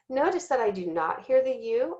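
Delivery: ordinary talk, nothing marked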